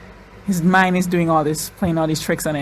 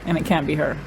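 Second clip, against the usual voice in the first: ordinary talk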